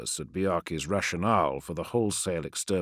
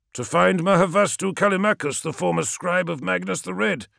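higher, louder angry reply; snapping; 12 semitones higher, 6 dB louder than usual. Acted. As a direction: keep snapping with contempt. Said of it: "snapped Menkora".

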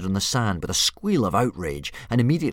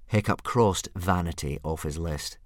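ordinary delivery

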